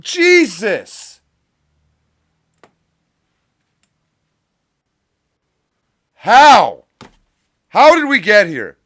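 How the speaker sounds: loud, high, outraged yelling; exasperated shouting at the news; furious rant. Real, not acted. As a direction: yell it at the screen in disbelief. Ranting at news on stream.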